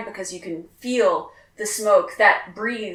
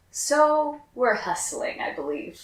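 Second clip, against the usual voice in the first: ordinary talk, nothing marked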